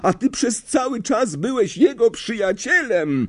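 very high-pitched accusation; shrill, betrayed outburst; furious shout. A narrator's reading. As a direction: climb into an outraged cry. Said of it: "krzyknął do Lapina".

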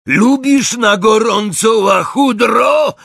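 high, loud, drawn-out jeer; yelled taunt. A narrator's reading. Crape yelling a taunt as he runs. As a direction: scream it mockingly, stretching the words. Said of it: "wrzasnął w biegu Crape".

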